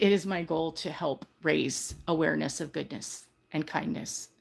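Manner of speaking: ordinary talk, nothing marked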